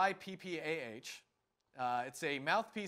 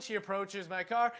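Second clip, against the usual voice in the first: ordinary talk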